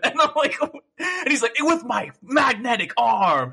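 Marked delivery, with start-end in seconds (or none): 1.6-3.5 high, slow, melodramatic villain boast; hammed-up villain. Villain threatening Snake with his arm, hammed-up. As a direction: draw it out like an over-the-top game villain.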